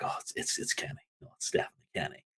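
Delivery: whispering like gossiping fans; low, voiceless, hurried murmur; hushed speculation. Retold or imitated. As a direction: imitate a rumor passed round under the breath. Voicing fans' hushed speculation.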